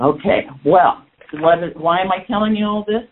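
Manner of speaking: normal talking voice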